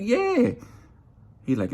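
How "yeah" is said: sharp high yeah; a leaping high yeah of curiosity; eager yeah. Retold or imitated. Eager to hear answer.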